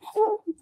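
high-pitched mock giggle; imitated giggle. Michael giggling while the fans take pictures, imitated high giggle. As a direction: giggle high like a tickled prankster. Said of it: "Mike over there just giggling".

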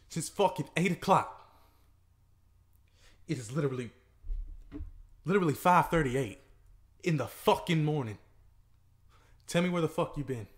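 rising furious questioning; angry confrontation. Acted. Man confronting girlfriend who came home at dawn, angry. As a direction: demand answers, building in anger.

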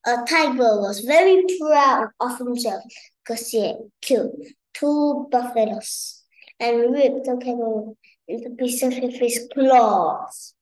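usual speaking voice

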